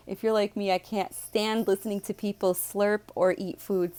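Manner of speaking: normal talking voice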